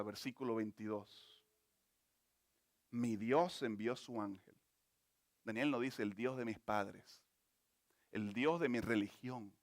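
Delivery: tender closing exhortation; soft intimate preaching. Acted. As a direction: draw the listeners close and comfort them. Closing part of the sermon.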